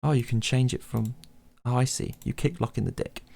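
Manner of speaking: usual speaking voice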